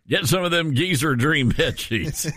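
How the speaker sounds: hammed-up Southern sales pitch; Southern accent. Host pitching bed sheets as a joke, exaggerated Southern accent. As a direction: lay on a mock Southern twang. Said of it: "I think it's your Southern accent".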